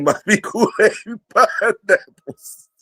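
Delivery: reading a viewer comment through real laughter; laughing while reading. Real, not acted. Reading a viewer's joke aloud to chat, laughing. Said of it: "Ben said".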